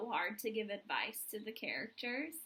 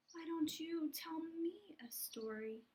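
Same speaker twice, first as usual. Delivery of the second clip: sleepy whisper, softer; yawning; 4 semitones higher, 7 dB quieter than usual. Acted. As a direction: yawn the words out tiredly. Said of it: "said Papa, yawning".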